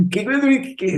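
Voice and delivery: high, startled qué qué qué; stunned disbelief. Retold or imitated. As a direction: caught completely off guard. Stunned at the Messi news.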